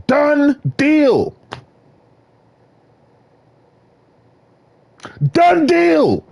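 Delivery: high, proud "Done deal"; triumphant. Acted. Vlogger proclaiming his fate, triumphant and high. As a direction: declare it settled, sky high.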